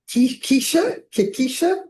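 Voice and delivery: normal talking voice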